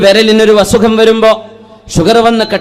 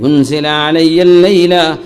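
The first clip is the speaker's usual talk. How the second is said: slow, sung Arabic chant; melodic Arabic recitation; 5 semitones lower, much slower than usual. Acted. Preacher chanting the Prophet's reply to the congregation, slow and sung. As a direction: intone it slowly like a recited hadith.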